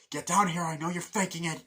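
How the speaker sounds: high, loud angry shout; angry yell. Retold or imitated. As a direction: yell at the machine, furious. Rooney yelling at answering machine.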